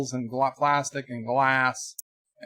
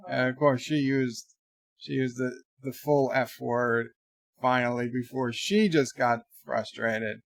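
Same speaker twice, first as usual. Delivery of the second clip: ordinary delivery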